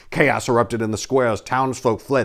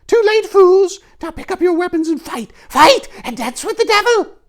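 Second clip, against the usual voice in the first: very high, loud witch shriek; 8 dB louder than usual. Acted. Witch taunting the paladins, shrieking high. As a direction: gloat like a mad witch.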